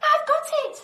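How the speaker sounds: high, loud eureka shout; excited cry. A narrator's reading. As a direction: burst out with the idea. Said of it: "she cried".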